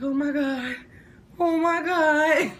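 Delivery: reenacted spaced-out dread; retold dazed "oh my God". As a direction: drag it out high, acting out being way too high. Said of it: "I'm just like this".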